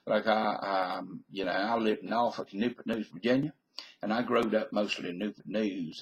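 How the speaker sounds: easygoing Southern US accent. Shown as a demonstration. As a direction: talk Southern, with little effort. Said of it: "Southern, because, you know, it doesn't take much energy".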